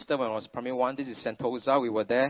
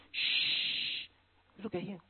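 put-on a breathy child's hush; whispered shush; 6 semitones higher, 8 dB quieter, much slower than usual. Son hushing scolding parents, whispered shush. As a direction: hush everyone like a secretive boy. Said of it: "he did this".

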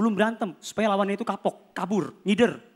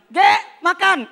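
higher, louder mother calling; mock mother calling out; mom calling; 6 semitones higher, 5 dB louder, much slower than usual. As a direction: call him to dinner like a mom. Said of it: "Nyokap gue bilang".

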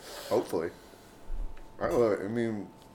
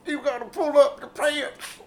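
Cosby impression; 4 dB louder than usual. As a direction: scold your son to pull up his pants. Mock Cosby scolding son.

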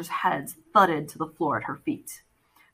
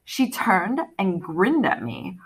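ordinary delivery

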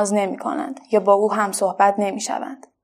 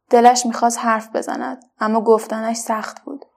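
usual speaking voice

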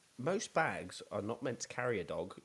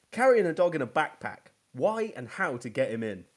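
1 mocking headline; 5 semitones higher, 8 dB louder than usual. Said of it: "Well, it says".

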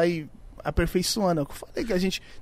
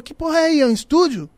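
high, loud call from below; kid calling up; 9 semitones higher, 5 dB louder than usual. Retold or imitated. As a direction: shout it up to a window, high. Kid shouting up from street. (moleque gritando da rua, agudo e alto)

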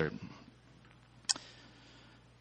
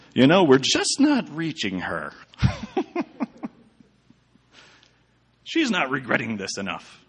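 mock Nazi guard; 5 semitones higher, 4 dB louder than usual. Mocking Nazi guards' frustration.

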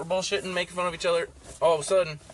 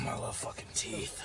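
creepy whisper; 3 semitones lower, 13 dB quieter than usual. Pretend. Voicing the tooth fairy.

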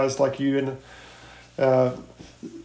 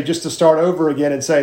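ordinary delivery

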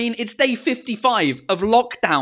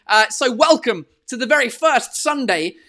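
announcer intro; 4 semitones higher than usual. Acted. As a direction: announce the show grandly. Host announces show title.

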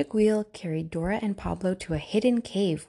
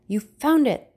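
triumphant crow, higher and louder; 6 semitones higher, 6 dB louder than usual. A narrator's reading. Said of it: "the pirate captain crowed".

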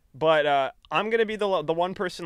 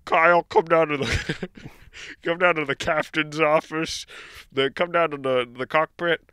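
principal's intercom call; 2 semitones higher, 6 dB louder than usual. Retold or imitated. Captain summoning Kyle to the office, mock intercom voice. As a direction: call him down like a school principal. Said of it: "the principal's office where I'm like".

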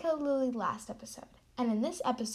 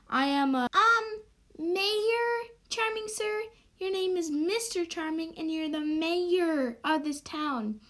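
pompous mayor; 6 semitones higher than usual. Acted. Mayor introduction.

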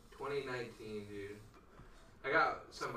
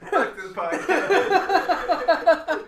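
much higher, louder and very slow, breaking into real laughter; laughing; 14 semitones higher, 17 dB louder, much slower than usual. Real, not acted. Cursing the podcast after his cohost mocks his dumb joke, real laughter. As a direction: laugh it out, barely getting the words through.

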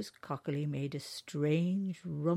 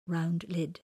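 usual speaking voice

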